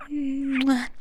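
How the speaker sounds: loud smacking kiss into the mic; kiss sound. Acted. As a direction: blow a big kiss at the listener.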